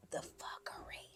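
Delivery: whispered, conspiratorial; teasing whisper. Pretend. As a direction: lean in and breathe it like a secret. Whispered teaser before the gossip.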